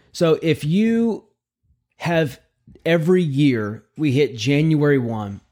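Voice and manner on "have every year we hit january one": ordinary talk, nothing marked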